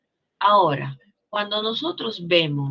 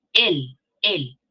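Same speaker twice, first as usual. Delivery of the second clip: "El, el" punched louder; emphatic el; 2 semitones lower, 7 dB louder than usual. Shown as a demonstration. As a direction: hammer the article "el" twice. Repeating the article for emphasis.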